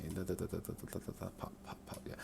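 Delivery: very soft, fading to a whisper; absent-minded muttering while thinking; muttering to oneself. Real, not acted. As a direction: mumble placeholder syllables under the breath. Mutters while working out the line.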